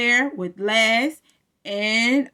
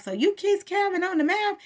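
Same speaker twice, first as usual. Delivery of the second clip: 1 mock shocked Maurice; 9 semitones higher than usual. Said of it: "Maurice is like".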